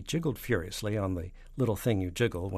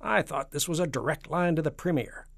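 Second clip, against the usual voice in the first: higher, louder protest; indignant complaint; 8 semitones higher, 5 dB louder than usual. Acted. President complaining to Nanny, indignant. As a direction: complain with raised pitch.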